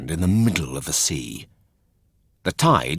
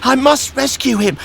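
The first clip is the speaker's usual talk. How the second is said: put-on high, loud boy's cry; determined puppet boy; 6 dB louder than usual. Pinocchio vows to save his father, high and loud. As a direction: shout it with brave resolve. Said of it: "shrieked Pinocchio".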